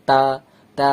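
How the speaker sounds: "তা" مرقق بفم رقيق مسطح; قل "তা" بشفاه مسطحة; thin-mouthed ta. Shown as a demonstration. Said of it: "মুখ পাতলা করে রাখতে হচ্ছে".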